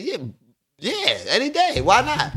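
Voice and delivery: high, breezy overconfident yes; cocky. Retold or imitated. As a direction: brush it off like nothing. Cocky acceptance of breathalyzer.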